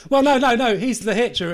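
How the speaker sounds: ordinary talk, nothing marked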